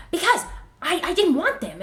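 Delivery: put-on loud, high, huffy kid voice; little brother getting mad at a silly question; mock mad. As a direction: imitate a kid snapping back when annoyed. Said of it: "he gets all mad".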